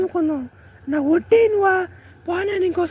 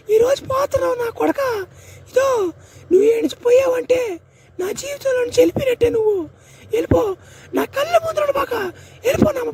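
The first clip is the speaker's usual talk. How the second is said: tearful pleading, pitch climbing as she breaks; wife crying as fisherman husband leaves for sea; 4 semitones higher than usual. Acted. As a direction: sob it out, begging him not to go.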